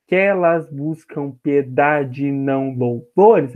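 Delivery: slow, even syllable-by-syllable reading; scanning the verse. Shown as a demonstration. Teacher repeating the verse for students, slow measured scansion. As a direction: measure the line out slowly, joining neighbouring vowels. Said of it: "Novamente, tá vendo".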